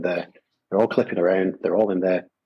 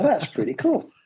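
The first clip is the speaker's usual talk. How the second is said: much higher, mock-impressed voice; impressed onlooker; 13 semitones higher than usual. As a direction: chirp it up like a wowed roadie. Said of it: "so they go".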